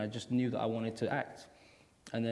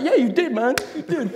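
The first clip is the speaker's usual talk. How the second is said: high, loud, giggly cool teacher; hammed-up laid-back agreement; laughing teacher agreeing; 14 semitones higher, 12 dB louder than usual. Teacher backing up the student, high laughing and loud. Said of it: "And he's like".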